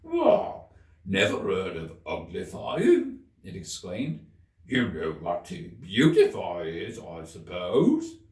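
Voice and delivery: be shocked she doesn't know; astonished Gryphon. A narrator's reading. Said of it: "lifted up both its paws in surprise".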